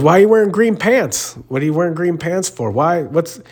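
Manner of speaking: high, rapid ribbing about the pants; mocking gym guy. Gym regular ribbing the teller about his green pants, high and fast.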